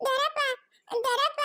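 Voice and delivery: high, tearful call to an uncle; crying little child. Acted. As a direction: wail for help like a small boy. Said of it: "Bablu ಅಳ್ತಾ ಇರೋದು".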